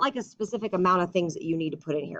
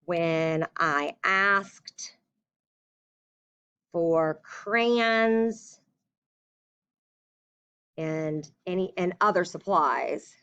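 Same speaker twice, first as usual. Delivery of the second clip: slow, halting dictation; drawn-out words while writing; dictating slowly; much slower than usual. Shown as a demonstration. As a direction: say it word by word as you write. Teacher dictates letter aloud.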